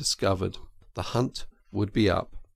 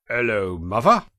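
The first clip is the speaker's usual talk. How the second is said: burly engine driver; 6 dB louder than usual. Acted.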